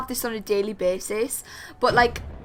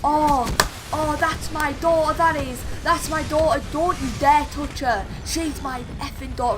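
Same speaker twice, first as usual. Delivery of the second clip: higher-pitched indignant mum impression; protective drunk mum; 5 semitones higher than usual. Mum defending daughter outside the club, indignant mock protest. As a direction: play a mum warning people off her daughter.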